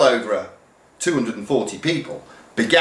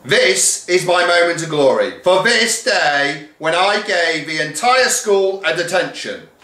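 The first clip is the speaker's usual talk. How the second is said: deputy head crowing; grand proclamation; 8 semitones higher, 3 dB louder than usual. Acted. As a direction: relish the moment. Deputy proclaims her glory.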